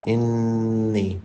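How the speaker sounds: انی with the doubled noon hummed through the nose and held; ghunna on noon. Shown as a demonstration. Teacher showing the ghunna in انی. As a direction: hum the nasal noon in انی. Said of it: "یہاں پہ غنہ کریں".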